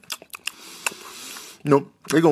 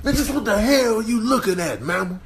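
high, agitated comic confrontation; mock indignant challenge; 7 semitones higher than usual. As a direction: confront a nosy onlooker. Challenges an onlooker.

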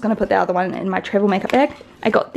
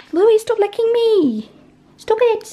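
high-pitched scolding of a dog; squeaky voice for the puppy; high pet-talk voice; 13 semitones higher, slower than usual. Real, not acted. Talking to her dog.

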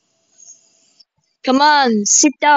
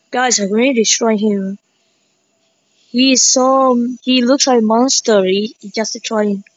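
ordinary talk

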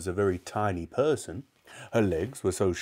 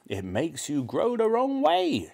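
put-on old-woman voice climbing high; snappish grandmother; 10 semitones higher than usual. A narrator's reading. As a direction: snap at the boy as a cross granny. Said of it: "she snapped".

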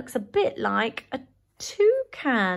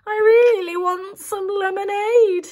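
high, louder bear roar then plea; 7 semitones higher, 4 dB louder than usual. Acted. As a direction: roar it, then beg for lemonade. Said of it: "said Gary".

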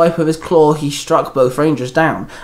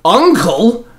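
high, loud startled question; shocked outburst, pitched up; 9 semitones higher, 5 dB louder than usual. A narrator's reading. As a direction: cry out in disbelief. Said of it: "everyone called out in shock".

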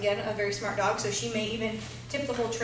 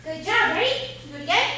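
excited dog praise; 7 semitones higher, 5 dB louder than usual. Real, not acted. Praising dog, cueing again.

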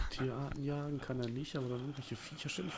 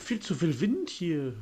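mock complaint; 5 semitones higher, 8 dB louder, slower than usual. Real, not acted. Excuse for missing the shot.

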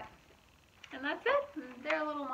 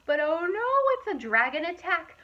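dramatic alarm; 7 semitones higher, 13 dB louder than usual. A narrator's reading. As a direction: sound the dragon alarm for the kids. Dramatic dragon moment in story.

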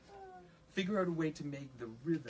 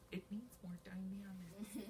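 hushed, breathy judge's note; a whispered mock comment; 5 semitones higher, 11 dB quieter than usual. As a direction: whisper it like a secret verdict. Teacher mimics judges' comment.

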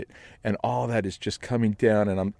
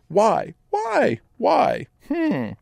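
high rising mock wondering; high hammed-up musing; mock puzzlement; much slower than usual. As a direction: ham up the mystery. Comic rhetorical questioning.

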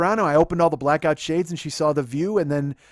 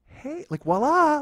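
sheepish; 9 semitones higher, 3 dB louder than usual. Retold or imitated. Sheepish boyfriend presenting his surprise to his girlfriend, timid. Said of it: "how sheepishly terrible it looked".

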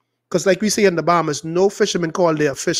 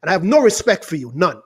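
ordinary talk

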